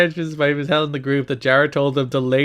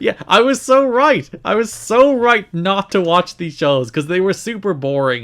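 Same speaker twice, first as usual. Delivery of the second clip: gleeful vindication; 6 semitones higher, 5 dB louder than usual. Real, not acted. Gloating about skipping shows.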